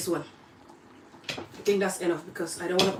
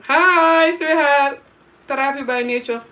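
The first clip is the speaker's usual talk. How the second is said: higher, louder excited comment reading; reading comments excitedly; 6 semitones higher, 8 dB louder, slower than usual. Real, not acted. Reading viewers' live comments aloud, excited and much louder. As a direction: greet the comment warmly and brightly. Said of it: "Are you guys writing?".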